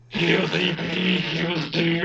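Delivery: flat robotic monotone voice; lightly done robot voice. Pretend. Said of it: "do you want me to do my robot voice".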